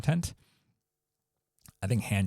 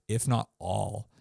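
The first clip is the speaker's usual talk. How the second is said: ordinary talk, nothing marked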